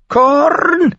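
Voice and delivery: high, loud bird screech; screaming raven. A narrator's reading. Mormont's raven screaming at John, piercingly high. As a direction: screech it like a raven, long held note. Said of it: "schrie Mormonts Rabe ihn an".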